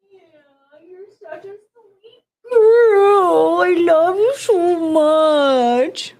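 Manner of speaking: very slow, high, loud cooing to the dog, almost crying. Real, not acted. Owner gushing to her sweet dog, tearful coo. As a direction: gush over the sweet dog, voice cracking.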